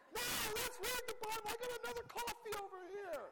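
high, loud, gleeful insistence; manic enthusiasm. Retold or imitated. To the weary Witnesses, high, loud and giddy. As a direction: keep them there with giddy zeal. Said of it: "they'd be like".

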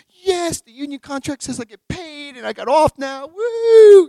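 high, rising whoop of joy; gleeful inner cheer. Retold or imitated. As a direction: cheer like you just got a day off. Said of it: "I'm just thinking".

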